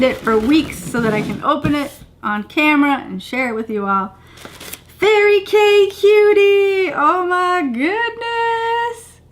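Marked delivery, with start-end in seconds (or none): 5.0-9.2 high, slow delighted squeal; excited squeal. Real, not acted. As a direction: gush over it in a squeal. Squealing at cute box reveal.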